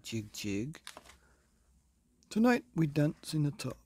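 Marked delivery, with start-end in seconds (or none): 2.3-3.8 louder, higher king's proclamation; booming king. Acted. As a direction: announce it grandly as the king. King answers in his voice.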